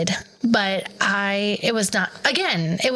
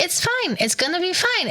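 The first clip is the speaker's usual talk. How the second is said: high, breezy mock reassurance; lightly mocking high "it's fine"; mock dismissive husband; 8 semitones higher than usual. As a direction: parody him waving away her worry. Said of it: "He was like".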